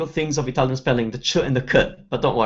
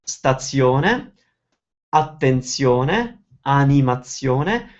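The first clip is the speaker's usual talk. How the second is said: stazione, attenzione, animazione, each slowly leaning on "-zio-ne"; stress on zione; much slower than usual. Shown as a demonstration. Teacher giving examples of the pattern, endings stressed. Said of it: "you always stress the zione".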